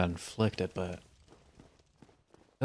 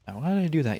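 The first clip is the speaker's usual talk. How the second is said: rhetorical question; 7 semitones higher, 4 dB louder, faster than usual. Real, not acted. Rhetorical self-question to audience.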